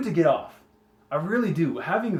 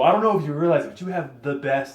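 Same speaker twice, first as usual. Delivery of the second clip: normal talking voice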